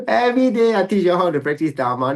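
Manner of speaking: high, loud exasperated protest; laughing, incredulous teacher's complaint; mock exasperation. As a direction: protest as if you can't believe they asked. Teacher mock-exasperated at students' question.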